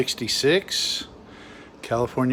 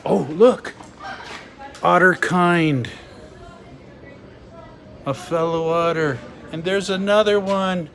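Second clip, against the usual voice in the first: high-pitched delight at spotting otters; excited exclaiming; 7 semitones higher, 5 dB louder than usual. Real, not acted. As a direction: exclaim it higher, thrilled by the find.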